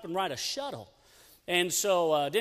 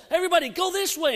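higher, louder and fast, herding everyone; calling out to the group; 10 semitones higher, 5 dB louder, faster than usual. Retold or imitated. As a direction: call the teens over urgently. Calling group to other line.

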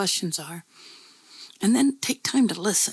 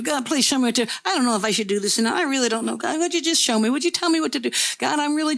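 higher and faster, pleading over and over; worked-up pleading prayer; 5 semitones higher, faster than usual. Retold or imitated. Young man anxiously begging God for direction, frantic pleading. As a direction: pray like you're getting all worked up and frantic. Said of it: "getting all worked up".

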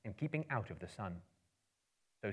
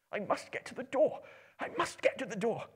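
anxious muttering; 13 semitones higher than usual. Acted. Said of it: "he kept on saying to himself".

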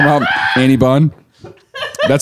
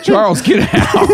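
much higher, slow, laughing; laughing mock call; 11 semitones higher than usual. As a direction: stretch it out mid-laugh. Laughing repeat of nickname.

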